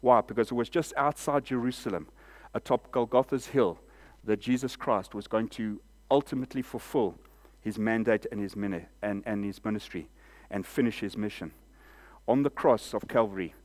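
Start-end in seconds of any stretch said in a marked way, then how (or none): none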